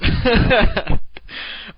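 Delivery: big high burst of laughter; loud laugh. Real, not acted. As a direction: crack up laughing hard. Laughs at own caster impression.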